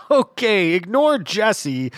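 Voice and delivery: high, loud laugh into the words; acted giggly, drawn-out reassurance; nervous laughing. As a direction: laugh it off to the robber. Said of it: "I started laughing immediately".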